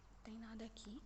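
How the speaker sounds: low tense whisper. Real, not acted. Exploring the dark house for viewers, whispering. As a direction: keep it to a whisper.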